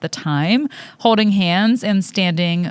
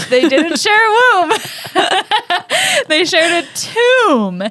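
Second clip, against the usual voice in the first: giggling correction; laughing; 9 semitones higher than usual. Real, not acted. Laughing at own typo.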